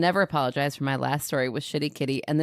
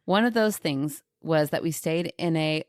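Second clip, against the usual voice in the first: ordinary talk, nothing marked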